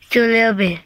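ordinary talk, nothing marked

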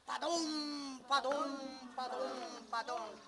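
loud, heavy stomps of the big goat; booming hoofbeats. A narrator's reading. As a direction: boom each step as the bridge thunders. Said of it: "el puente retumbaba y hacía".

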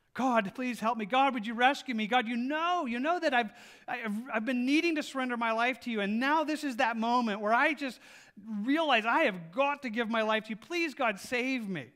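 man dangling from a root, pleading loudly; desperate prayer. Acted. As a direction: beg for rescue while hanging off a cliff. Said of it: "He cries, screams for help".

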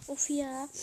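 normal talking voice

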